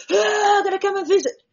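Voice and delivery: high, loud excited yelp; mock a diver rushing in thrilled; overeager diver shout. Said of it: "see the shark in my lap and go".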